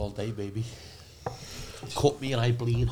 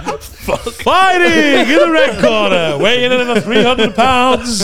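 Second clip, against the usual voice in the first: much higher, louder, very slow hammed-up ring announcer; Bruce Buffer; 9 semitones higher, 11 dB louder, much slower than usual. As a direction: stretch every word like a boxing MC. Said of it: "Bruce Buffer".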